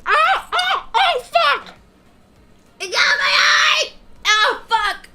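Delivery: sudden yelps of pain, high; pained yelling. Real, not acted. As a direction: cry out in shock and pain. Chili juice in the eye.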